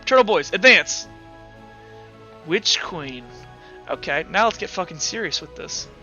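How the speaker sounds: usual speaking voice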